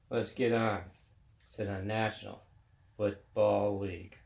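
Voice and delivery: ordinary talk, nothing marked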